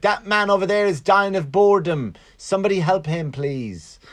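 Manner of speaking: a hint of a British star calling for help; mocking celebrity plea. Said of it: "someone's like".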